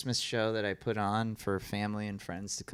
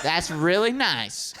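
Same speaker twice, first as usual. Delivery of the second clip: heckler; 7 semitones higher, 5 dB louder, slower than usual. Retold or imitated. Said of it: "And he goes".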